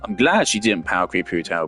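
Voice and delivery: ordinary delivery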